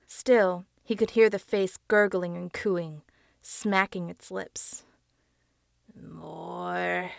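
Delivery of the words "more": soft, drawn-out growled "More"; low growl. Acted. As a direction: growl it like a hungry demon. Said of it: "the face growled once more".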